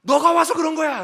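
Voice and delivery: loud, high outburst of blame; accusing widow. Acted. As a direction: act out a bereaved widow lashing out. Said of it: "그 과부가".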